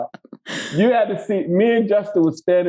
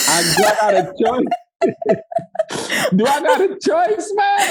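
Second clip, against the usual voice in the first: high, laughing do I got a choice; laughing protest; 10 semitones higher than usual. Retold or imitated. Actor answering director's retake request, high laughing protest. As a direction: crack up while pleading. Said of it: "And I'm like, "Bruh".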